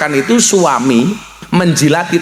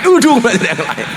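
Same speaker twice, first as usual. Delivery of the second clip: higher, laughing through the warning; laughing repeat; 7 semitones higher than usual. Shown as a demonstration. Preacher laughing as he repeats the joking warning.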